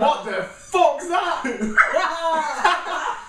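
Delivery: high, slow laughing shriek. Real, not acted. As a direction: squeal it out laughing. Laughing disbelief.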